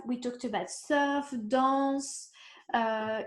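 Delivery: usual speaking voice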